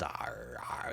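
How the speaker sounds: low dull chewing grunts; drooling stupor grunts; mindless grunting. A narrator's reading. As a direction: grunt like a passed-out brute chewing nothing. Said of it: "mindless eating motions".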